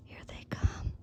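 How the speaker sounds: hushed whisper as they approach; whispering while hiding. Real, not acted. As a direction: barely breathe the words. Family arriving, still hiding.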